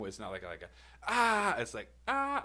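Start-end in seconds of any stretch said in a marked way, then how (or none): 1.1-1.6 high, loud 'ah' yell; mid scream. Retold or imitated. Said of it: "I'm, like, mid scream". 2.1-2.4 very high, loud scream; scream finally coming out. Retold or imitated. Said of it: "the volume's finally coming out".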